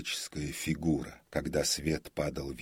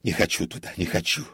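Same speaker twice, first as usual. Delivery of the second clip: louder, agitated refusal; frenzied muttering; 3 semitones higher, 8 dB louder, faster than usual. A narrator's reading. Comic refuses to go home, frenzied. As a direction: repeat it in a frenzy. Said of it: "бормотал комик в каком-то исступлении".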